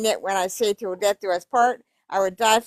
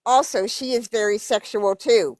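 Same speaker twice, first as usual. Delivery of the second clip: normal talking voice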